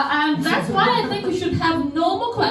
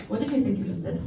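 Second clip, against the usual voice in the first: mock scolding; 9 semitones lower, 7 dB quieter, much faster than usual. Mock scolding after paper thrown.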